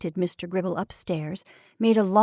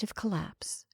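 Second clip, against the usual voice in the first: whispered; 11 dB quieter than usual. A narrator's reading. Narrating her collapse.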